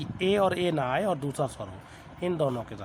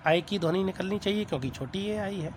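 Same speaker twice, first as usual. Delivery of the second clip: ordinary talk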